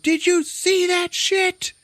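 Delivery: very high, loud, drawn-out yell; hammed-up freak-out. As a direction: shriek it in slow mock disbelief. Tom Cruise freaked out, acted.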